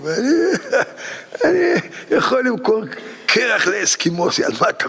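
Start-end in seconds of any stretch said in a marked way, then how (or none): 0.3-1.4 a high laugh breaking near a cry; real laugh. Real, not acted. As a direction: let the laugh spill over. Laughing at his own boast. (צחוק גבוה כמעט בכי)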